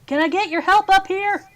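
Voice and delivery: high, loud call down below; calling down the stairs. Acted. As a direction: shout down to Yuka below. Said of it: "he calls down".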